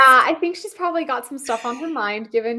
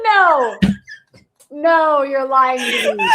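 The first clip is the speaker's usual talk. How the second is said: slow, laughing protest; laughing disbelief; 5 semitones higher, 7 dB louder, slower than usual. Real, not acted. Friend laughing in disbelief at the story.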